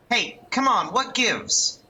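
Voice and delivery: high-pitched, put-out protest; protesting young man. Acted. Young man to the one who yanked away, protesting.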